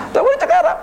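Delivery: sudden high-pitched amused outburst; a laughing, incredulous cry; amused exclamation. Real, not acted. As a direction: toss it up high in disbelief. Incredulous punchline after laughing.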